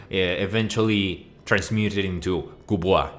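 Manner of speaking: ordinary talk, nothing marked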